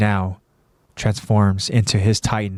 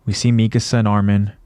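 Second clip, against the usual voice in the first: plain reading voice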